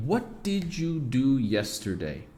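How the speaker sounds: slow, clear word-by-word English; careful slow version. Shown as a demonstration. Teacher to students, slow careful English model. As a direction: say "what did you do yesterday?" slowly, each word apart. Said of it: "A segunda pergunta aqui".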